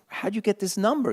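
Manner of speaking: as if a ghost just called; high surprised question to a late caller; astonished. Retold or imitated. His own astonished reply at 1:30am.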